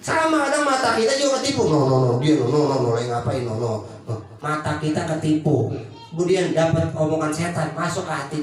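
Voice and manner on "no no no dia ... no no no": low, fast repeated no's; mock denial. Preacher mimics a woman waving off blame. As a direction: brush it off like a gossip caught out.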